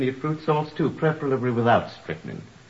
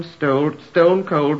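usual speaking voice

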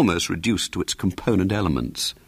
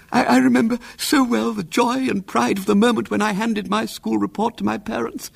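tearful aunt; 14 semitones higher than usual. A narrator's reading. Moved aunt praising William's sacrifice, high and tearful. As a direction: choke up with emotion.

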